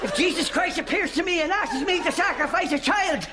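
high half-shouted rant; exasperated rant. Acted. Mother ranting to family about daughter, half-shouted. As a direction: rant with mock fury.

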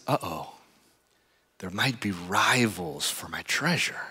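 slow hushed whisper, afraid of rivals; secretive whisper. Retold or imitated. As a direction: whisper it so no one hears. Farmer's worried secret thought.

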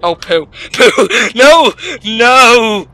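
ordinary talk

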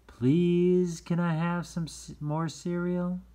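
put-on high, loud child voice asking. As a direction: play a little kid asking politely. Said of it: "please sentence".

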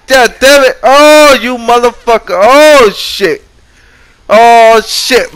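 high, loud curses as he dies; frantic yelling. Real, not acted. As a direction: curse loudly as the character dies. Dying in game, yelling.

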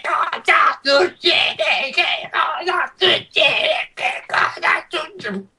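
high, slow, whiny goblin cries; shrill panicked goblin squeal. Acted. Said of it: "il goblin ovviamente dice".

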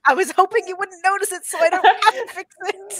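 high, loud mock whine through a laugh; mock exasperation. Artist replying to someone pointing out a flaw, mock exasperated. Acting own reply to critique.

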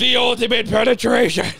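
loud, high hammed-up yell; shouted proclamation. Joking to a friend mid-fall, loud mock proclamation. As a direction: bellow it like a grand title. Joke yelled during fall.

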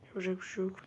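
usual speaking voice

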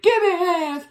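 high, shouted chant. Acted. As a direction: call it out high. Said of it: "They shouted".

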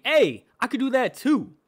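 high, loud, excited boast; punchy high-pitched enthusiasm; eager me-too shout. Acted. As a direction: call it out high and keen. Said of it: "it was my way of saying".

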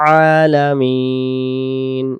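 আলামিন with আ pressed from the mid-throat, slow; pressed throat ain. Shown as a demonstration. Teacher modelling the pressed আইন sound. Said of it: "নুন অক্ষরের সাথে মিলিত হয়ে ছেড়ে দেব".

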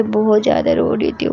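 usual speaking voice

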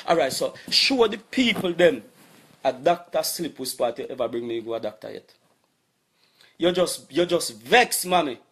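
normal talking voice

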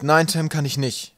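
loud, slow, curt refusal; brusque interruption. A narrator's reading. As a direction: cut in gruffly and firmly. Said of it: "unterbrach Wolkenwolf ihn schroff".

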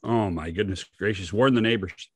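ordinary delivery

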